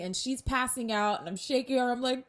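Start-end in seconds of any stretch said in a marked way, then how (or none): none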